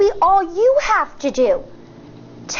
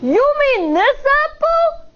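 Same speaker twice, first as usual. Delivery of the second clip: much higher, teasing bully voice; taunting question; 10 semitones higher than usual. Acted. Bully taunting the firefly with the apple. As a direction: dangle it in front of him. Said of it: "he questions".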